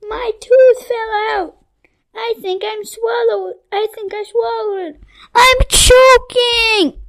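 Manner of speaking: high loud panicked whining. A narrator's reading. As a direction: wail it in panic. Crybaby panics after meatball.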